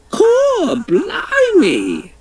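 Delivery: high, slow, crying yell of surprise; high, drawn-out Cockney cry of alarm. Acted. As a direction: shriek it out as she lurches into the air. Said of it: "yelled the Queen".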